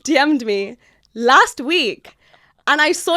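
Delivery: slow, laughing through the words; laughing reveal. Real, not acted. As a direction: spill the news while laughing.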